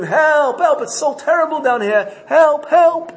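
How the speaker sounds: high-pitched pleading yell for help; retold scream. Slave in the dungeon calling for help, screaming. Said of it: "the slave in the dungeon would scream".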